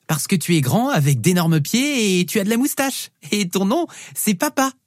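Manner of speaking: put-on high child voice, climbing as it goes; little girl explaining. Daughter proving he is Papa, high child voice.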